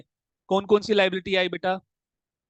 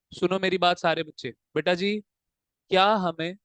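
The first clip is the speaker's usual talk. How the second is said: usual speaking voice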